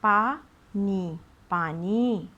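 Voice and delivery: पा नी very slow, पानी stretched long; drawn-out syllables. Shown as a demonstration. Teacher stretches the long vowel in पानी. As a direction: sound out पा नी slowly, then पानी. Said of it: "बड़ी की मात्रा ... लंबी समझ में आएगी".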